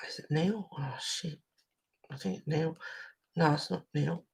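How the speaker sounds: soft unvoiced self-questioning; whispered muttering while trying to remember; whispered to herself. Real, not acted. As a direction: hush it as she tries to recall a name. Unsure of a name, aside.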